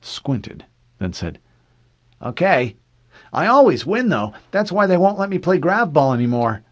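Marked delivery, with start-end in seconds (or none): 2.2-6.6 put-on high, loud little-boy voice; excited child piping up loudly; eager young boy. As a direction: brag like a confident kid, pitched way up.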